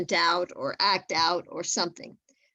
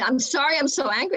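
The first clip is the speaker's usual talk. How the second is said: pitched up, a mild mock of someone apologizing in anger; imitating an upset person; 6 semitones higher, 3 dB louder than usual. Speaker quoting an angry person who apologizes, pitched up. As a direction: quote the angry person higher, half-acted. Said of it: "they say".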